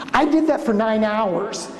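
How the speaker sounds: normal talking voice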